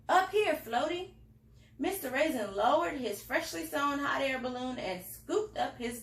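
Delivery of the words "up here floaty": calling out. A narrator's reading. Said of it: "a voice called from overhead".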